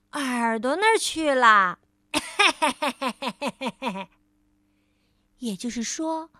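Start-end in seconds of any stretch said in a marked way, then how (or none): 2.1-4.0 merry laugh in character; Mr. Tickle grinning ear to ear; gleeful chuckle. Acted. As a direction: chuckle with delight.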